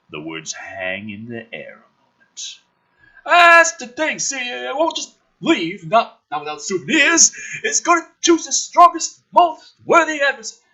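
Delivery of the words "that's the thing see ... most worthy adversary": hammed-up character line. Reads character dialogue, higher.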